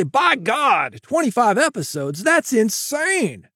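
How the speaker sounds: mock-shocked listener balking at the workload; mock disbelief. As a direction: ham up an outraged skeptic. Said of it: "People say".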